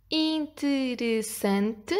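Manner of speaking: very slow, separated syllables. Shown as a demonstration. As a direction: sound the word out in five beats. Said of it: "tem cinco sílabas".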